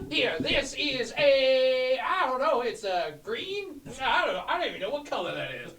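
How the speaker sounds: over-the-top fish-man merchant hawking potions; wacky potion seller. Acted. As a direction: sell it like a carnival huckster. Player voices potion seller figure.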